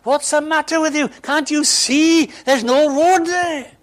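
high, loud mock yell at the sat nav; exasperated shout. Driver yelling at the sat nav over the dug-up road, exasperated shout. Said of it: "I felt like shouting at her".

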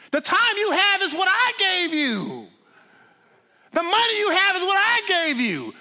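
high, loud, hammering preacher's cry; fiery God confronting a soul. Acted. Preacher as God questions a wasted life, loud and high. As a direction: thunder at them as God demanding an account.

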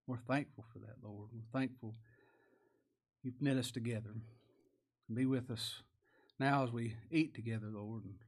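hushed, unhurried thanks to God; reverent prayer. Real, not acted. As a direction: pray aloud quietly and humbly, phrase by phrase. Leads closing prayer.

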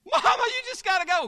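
high, loud hurry-up plea; urgent urging. Acted. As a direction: pull her along, no time to lose. Daughter urging mother in skit.

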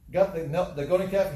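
ordinary delivery

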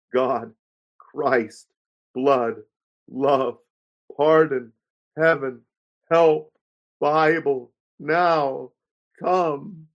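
each word set apart by a long pause; halting, word by word with pauses; slow with pauses. A narrator's reading. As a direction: pronounce every word slowly, pausing after each. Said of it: "slowly pronounced the words with a pause almost at every syllable".

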